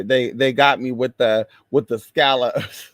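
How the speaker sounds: ordinary delivery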